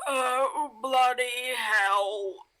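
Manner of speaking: long, drawn-out groan of effort; acted groggy, slow moaning. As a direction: groan it slowly as you haul yourself upright. Character painfully pulls himself upright.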